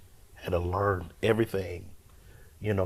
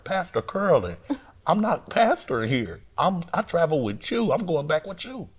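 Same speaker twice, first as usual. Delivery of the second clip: higher, more insistent retold protest; getting louder; 9 semitones higher than usual. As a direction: press the point to someone ignoring you. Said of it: "I'm getting louder".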